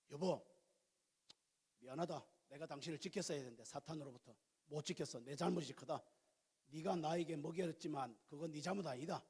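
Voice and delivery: low, soft apology to a wife; acted husband's quiet contrition; tender apology. Models Adam apologising to his wife.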